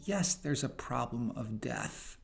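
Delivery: ordinary talk, nothing marked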